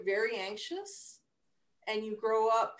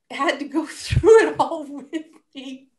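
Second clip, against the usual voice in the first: louder, laughing through the words; 7 semitones higher, 14 dB louder than usual. Real, not acted. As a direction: laugh while thanking your partner. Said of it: "laughs heard".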